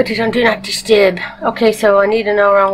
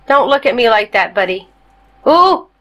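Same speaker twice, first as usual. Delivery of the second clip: normal talking voice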